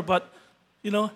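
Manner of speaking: ordinary delivery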